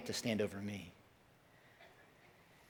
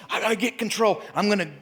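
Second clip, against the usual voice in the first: high, loud fretting self-talk; anxious inner voice; 7 semitones higher, 5 dB louder than usual. Retold or imitated. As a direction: play the panicking controller. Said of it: "we go".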